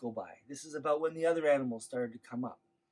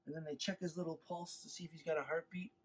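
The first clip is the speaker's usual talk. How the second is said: ordinary delivery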